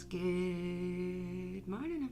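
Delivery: very long, low drawn-out greeting; Robin Williams. Retold or imitated. Live host greets viewers like the film, drawn-out impression. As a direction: hold the first word, then swoop up. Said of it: "I always want to say good morning, Vietnam".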